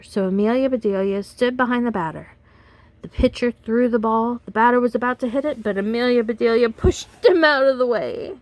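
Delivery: put-on lively read-aloud for kids; animated storyteller voice for children; storybook reading. A narrator's reading. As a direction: read it like bedtime story time. Reads picture book to children.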